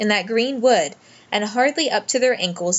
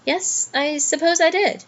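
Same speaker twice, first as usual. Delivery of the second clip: puzzled; 8 semitones higher than usual. A narrator's reading. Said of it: "with a puzzled air".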